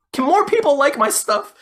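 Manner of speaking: high, loud comic begging; hammed-up whiny appeal for attention; mock plea. Voices a writer pleading for likes.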